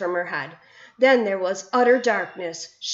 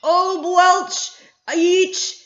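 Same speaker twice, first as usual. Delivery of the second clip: much higher, loud disgusted bellow; disgusted roar; 10 semitones higher, 8 dB louder, much slower than usual. A narrator's reading. Bloodbottler tasting snozcumber, disgusted roar. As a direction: spit out the words in revulsion.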